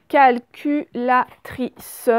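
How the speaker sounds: CALCULATRICE, loud and slow, syllable by syllable; teacher hammering each syllable of CALCULATRICE; split into syllables. Shown as a demonstration. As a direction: break CALCULATRICE apart, sounding the final SE. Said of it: "On entend ce juste à la fin".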